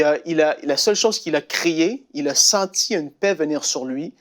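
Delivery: ordinary delivery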